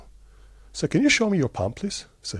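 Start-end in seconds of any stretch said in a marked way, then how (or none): 0.9-2.1 raised pitch, asked once with a light polite lilt; first ask, pitched up and polite, to the old teacher; asking to see palm. Retold or imitated. Retelling the plane meeting, asking the teacher to show his palm, mildly higher polite request.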